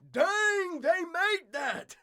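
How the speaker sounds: high, loud, slow amazed exclaim; wow-struck theme park guest. Retold or imitated. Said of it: "you're just like".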